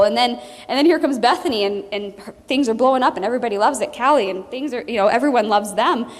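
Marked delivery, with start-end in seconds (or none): none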